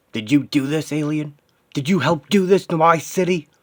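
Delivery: high, loud accusing shout; furious interrogation. Acted. Hero confronting an alien over his destroyed city, furious. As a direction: demand an answer at the top of your voice.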